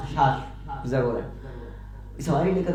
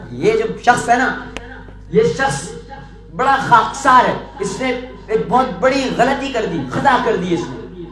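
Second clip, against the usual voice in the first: accusing courtiers; 5 semitones higher, 5 dB louder than usual. Acted. Courtiers accuse beggar to king.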